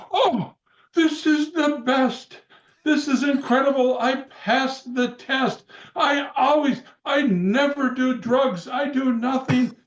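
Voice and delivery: imitating the Iron Sheik. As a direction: ham up the Sheik's proud denial, drawn out. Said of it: "And the Sheik goes".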